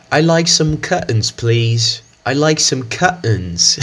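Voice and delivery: hammed-up British accent. Shown as a demonstration. Teacher plays a British speaker in jest. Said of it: "pessoas falando curtains".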